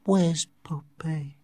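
slow, breathy whisper. A narrator's reading. Dying Linda asking after Poppy, slow whisper. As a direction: whisper it weakly and slowly. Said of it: "she whispered".

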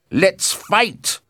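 high, loud battle cry; fierce challenge. A narrator's reading. Tybalt answers Mercutio's challenge, loud and high. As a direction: take up the fight at a shout.

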